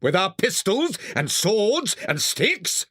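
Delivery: high, eager call without much volume; mild excited shout. A narrator's reading. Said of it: "shouted the rat".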